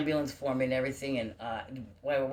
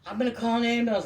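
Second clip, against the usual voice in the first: mock yell, much higher, louder and fast; retold yell; 10 semitones higher, 6 dB louder, faster than usual. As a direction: imitate her yelling in a hurry. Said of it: "well, she's yelling".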